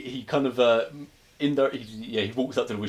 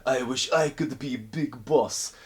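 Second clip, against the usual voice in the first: high, slow put-on Russian voice; mock Russian accent; 3 semitones higher, slower than usual. Krichkov explaining to the Wishmaster, mock Russian accent. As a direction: drag it out like a nervous Russian gangster.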